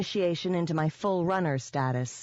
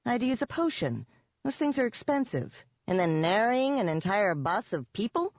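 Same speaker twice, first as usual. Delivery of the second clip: defensive, pitch climbing; 7 semitones higher than usual. A narrator's reading. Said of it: "I said defensively".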